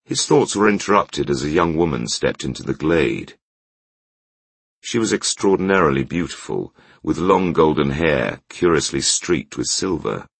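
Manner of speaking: usual speaking voice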